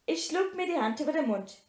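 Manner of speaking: ordinary delivery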